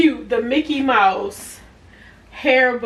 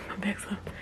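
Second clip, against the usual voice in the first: whispered aside; 2 semitones lower, 13 dB quieter than usual. Real, not acted. Aside while adjusting camera.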